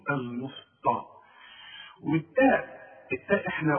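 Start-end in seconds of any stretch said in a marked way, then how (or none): 0.1-1.0 المسطى with an emphatic t; wrong heavy ta. Shown as a demonstration. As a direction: thicken the t in المسطى. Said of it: "كتير جدًا بيغلط فيها ويقول". (المسطى بطاء مفخمة)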